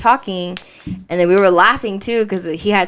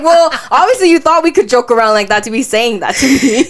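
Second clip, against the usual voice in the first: louder, laughing comeback; laughing retort; 5 semitones higher, 6 dB louder than usual. Retold or imitated. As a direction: tease him through laughter.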